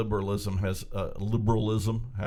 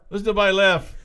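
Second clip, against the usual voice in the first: nobody punched higher and louder; louder repeat; 7 semitones higher, 6 dB louder than usual. Real, not acted. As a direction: call it out louder the second time. Repeats closing joke louder.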